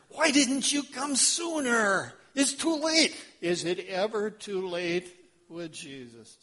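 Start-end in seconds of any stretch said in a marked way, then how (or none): 0.1-3.1 higher, anguished self-blame; self-reproach. Acted. Jairus kicking himself, higher anguished voice. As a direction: berate yourself, too late. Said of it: "Kicking himself hard".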